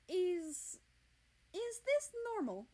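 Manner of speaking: startled prince, voice jumping up; Russia alarmed. A narrator's reading. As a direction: stammer it out, rattled.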